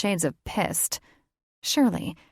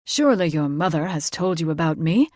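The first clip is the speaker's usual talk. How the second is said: louder, pointed fae lord; fae father, probing; 5 dB louder, faster than usual. A narrator's reading. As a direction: press her with faint reproach. Fae father questions daughter.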